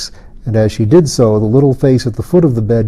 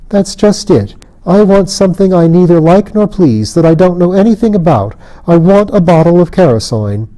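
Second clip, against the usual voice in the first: a hint of whiny spoilt-child wail; spoilt child crying; 6 semitones higher than usual. A narrator's reading. Fairy queen whines to Colin for Carasoin, mildly spoilt-child crying. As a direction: lightly whimper like a spoilt child. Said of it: "she cried like a spoilt child".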